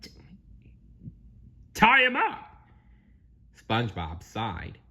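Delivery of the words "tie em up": "tie 'em up" high and loud; garbled teamwork. Acted.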